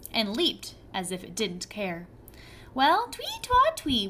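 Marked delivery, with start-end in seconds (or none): 2.7-4.1 much higher whistle sound; whistled twee. A narrator's reading. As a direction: chirp the tune. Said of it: "whistled the boy".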